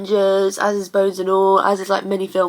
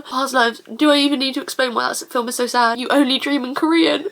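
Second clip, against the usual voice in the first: usual speaking voice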